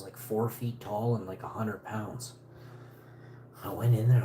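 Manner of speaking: ordinary talk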